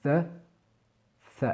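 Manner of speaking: The, tongue between teeth, light buzz; the avec th sonore; voiced th. Shown as a demonstration. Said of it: "vous voyez vraiment la différence".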